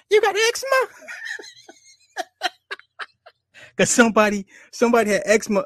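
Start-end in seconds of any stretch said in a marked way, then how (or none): 0.1-0.9 put-on high squeaky little-kid voice, asking in disbelief; little boy on Vine. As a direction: pitch way up like a tiny toddler teasing. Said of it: "that little boy on Vine".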